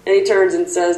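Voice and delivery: usual speaking voice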